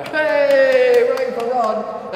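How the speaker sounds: high, drawn-out roaring cheer; wild crowd cheer. Acted. The crowd greeting Rod as he enters, wild cheering. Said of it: "the crowd goes wild".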